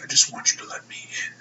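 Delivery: creepy, serious whisper; whispered plea, deadly serious; all serious. Retold or imitated. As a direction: imitate a menacing wrestler in a hushed whisper. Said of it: "he gets all serious".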